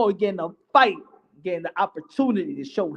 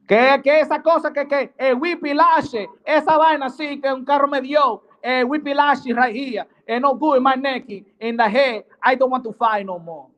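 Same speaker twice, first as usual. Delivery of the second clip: higher, hammed-up Spanish-accented crash victim; mock Spanish accent; 5 semitones higher than usual. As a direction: complain in Spanglish with a thick put-on Spanish accent. Comic Spanish-speaking crash victim bit.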